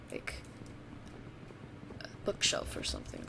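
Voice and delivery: ordinary delivery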